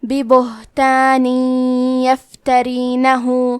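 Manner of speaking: ببہتان and یفترینہ recited slowly, nasal غنہ held; recite with ghunnah and shaddah. Shown as a demonstration. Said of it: "غنہ اور تشدید کے ساتھ ادا کریں گے".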